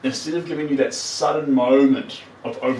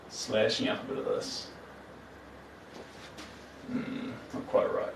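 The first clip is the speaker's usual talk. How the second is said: muttering; 11 dB quieter than usual. Real, not acted. Muttering to self while painting.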